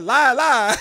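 mock country twang on Lie, lie; imitating a drawled Lie, lie; country accent. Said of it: "the way you said".

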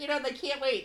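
usual speaking voice

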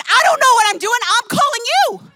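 high, loud, half-laughing plea; laughing exasperation. Retold or imitated. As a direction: throw up your hands, laughing. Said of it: "And I said".

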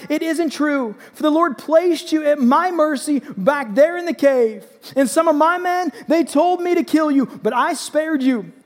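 high, loud, impassioned protest; shouted appeal. A narrator's reading. David defends himself to King Saul, shouting. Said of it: "And then he shouted to Saul".

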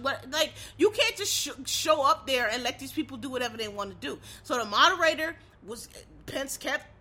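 normal talking voice

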